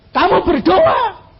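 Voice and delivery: slow, loud, high order to pray; firm command. Retold or imitated. Pastor orders the frightened caller to pray: slow loud command. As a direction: tell him to pray, slow and forceful.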